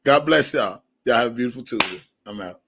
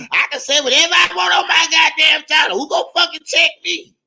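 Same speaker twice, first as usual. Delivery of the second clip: mock ranting woman; 12 semitones higher than usual. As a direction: shriek it like the furious lady. Imitating the lady's rant.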